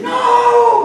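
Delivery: very high, loud, long cry; screaming children. Retold or imitated. Brother and I protecting the duck, screaming. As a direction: scream it like horrified kids. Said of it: "My brother and I are screaming".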